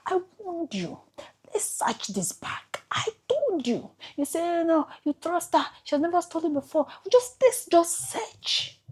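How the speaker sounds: high-pitched mock nagging, told-you-so; imitating a scolding voice. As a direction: play the voice in her head scolding her. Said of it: "I might sit and ask".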